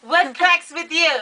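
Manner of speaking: high-pitched mock African accent; African accent imitation. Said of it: "He's always saying".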